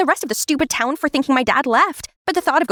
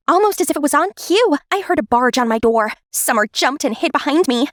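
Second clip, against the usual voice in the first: ordinary delivery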